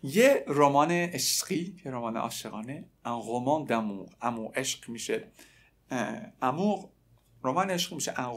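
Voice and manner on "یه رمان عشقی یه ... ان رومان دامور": ordinary talk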